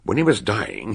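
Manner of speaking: ordinary talk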